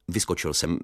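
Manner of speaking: usual speaking voice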